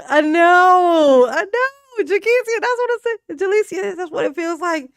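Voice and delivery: loud, high hyped-up shouting; yelling back at the chat all fired up; excited shout. Real, not acted. Streamer shouting back at the chat, loud and high.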